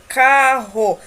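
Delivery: "carro" said loudly with a strong RR; double R. Shown as a demonstration. Teacher showing R between vowels in "carro", forceful demonstration. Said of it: "Quando usamos os dois R".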